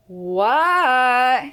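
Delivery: loud, drawn-out answer back; called answer. Acted. Froggy answering his mother's call, loud. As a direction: shout back across the yard.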